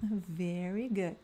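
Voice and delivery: slow, soft teacher praise. Acted. Teacher praising students after each repeat, soft and slow. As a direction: reassure the learner gently.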